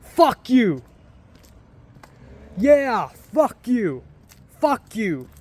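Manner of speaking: loud, high mock-angry curse; drunken yell. Drunk vlogger cursing at the empty street, loud mock anger. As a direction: hurl it at the empty street, half playing.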